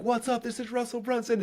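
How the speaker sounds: high, hyped-up livestream greeting; over-enthusiastic host intro; high-energy opener. Acted. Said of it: "I started that level".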